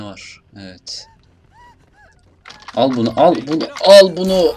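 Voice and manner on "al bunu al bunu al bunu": raised voice climbing to a high yell; louder and higher with each repeat; building battle shout. Real, not acted. Player attacking an enemy, shouts building to a yell.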